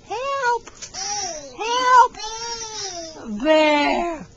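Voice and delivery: high, loud squeal of pretend fear at the bear; mock fright. To the toddler in bear claws, high mock-scared squeal.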